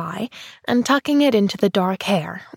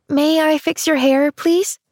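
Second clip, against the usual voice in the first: put-on higher, bright-eyed young girl; little girl voice; 7 semitones higher than usual. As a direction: play an eager little girl. Child's voice, eager.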